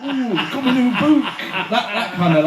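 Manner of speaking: high cheeky Yorkshire flirt, laughing; thick Yorkshire accent. Pretend. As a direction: tease in a broad Yorkshire voice. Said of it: "a really thick, like, Yorkshire".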